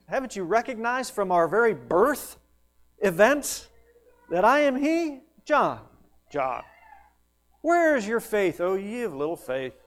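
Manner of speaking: hammed-up exasperated reproach; mock scolding. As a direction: berate him with rising, disbelieving questions. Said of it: "Didn't beat him up for a lack of faith".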